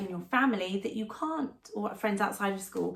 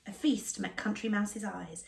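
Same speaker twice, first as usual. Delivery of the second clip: plain reading voice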